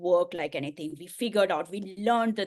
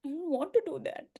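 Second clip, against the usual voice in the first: tearful; 8 semitones higher than usual. Retold or imitated. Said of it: "all the tears rolling".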